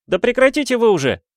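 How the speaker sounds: high, loud, fast outburst; indignant cry. A narrator's reading. Student shakes off the servant brushing him, indignant high shout. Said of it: "вскричал он".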